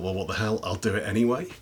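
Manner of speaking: ordinary delivery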